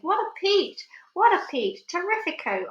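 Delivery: high excited cries; ecstatic. Acted. Aunt thrilled by the peach, high excited cries. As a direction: gush with delight. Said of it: "Aunt Sponge cried out".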